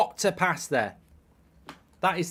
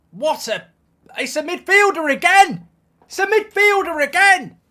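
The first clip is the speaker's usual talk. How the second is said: higher, louder, fed-up rant; exasperated outburst; 8 semitones higher, 6 dB louder than usual. Real, not acted. Fan raging at another conceded goal, exasperated. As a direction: spit it out in disbelief.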